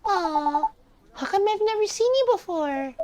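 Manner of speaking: put-on high-pitched curious child; high little kid, rising question. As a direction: ask like a curious nine-year-old. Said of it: "I'm reading in this kid voice".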